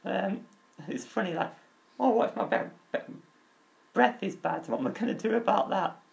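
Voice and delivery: ordinary delivery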